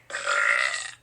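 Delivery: small deep burp; weak burp. Real, not acted. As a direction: squeeze out a little belch. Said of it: "supply of burps".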